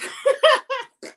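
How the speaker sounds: a high, bright burst of laughter at herself. Real, not acted. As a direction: laugh it off, amused at sounding like a teacher. Laughs at sounding like class.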